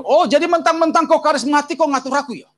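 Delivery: loud, high-pitched angry outburst; acted Medan-style tirade; angry Medan father. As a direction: roar like an offended military father. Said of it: "gaya Medannya".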